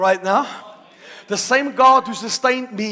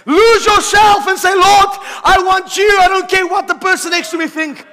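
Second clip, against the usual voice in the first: higher and much louder, near shouting; shouting preacher; 9 semitones higher, 10 dB louder than usual. Acted. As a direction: whip up the congregation with every line. Preacher building to shouting climax.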